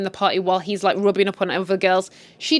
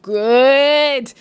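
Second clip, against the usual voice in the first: long, loud, satisfied cry; loud good; 6 semitones higher, 7 dB louder than usual. Real, not acted. Vlogger cheering as the husband leaves, loud and long. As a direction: cheer it out with relish. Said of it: "And I'm like".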